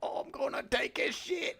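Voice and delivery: mock Cockney yell. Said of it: "I don't know Cockney stuff".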